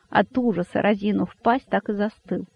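usual speaking voice

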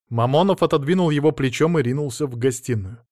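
ordinary delivery

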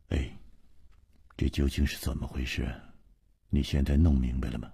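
low, soft, quick questioning; can't hold back asking. A narrator's reading. Glaring companion asks what is going on, unable to hold back. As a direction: ask in a low, hushed, hurried voice. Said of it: "忍不住说".

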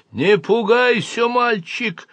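high, slow alien voice; voice inside head. A narrator's reading. Alien speaks in the boy's mind, high drawn-out voice. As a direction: stretch it out, pitched well up. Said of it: "прозвучал голос в моём уме".

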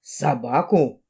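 low, drawn-out sceptical query; deep, slow officer's question; frowning policeman. A narrator's reading. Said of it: "нахмурился полицейский".

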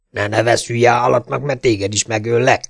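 plain reading voice

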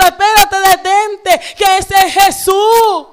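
high, loud cry to stop and look; urgent shout. Retold or imitated. Disciple shouting to Peter, loud and urgent. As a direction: call out to him, it's Jesus. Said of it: "le dijo a Pedro".

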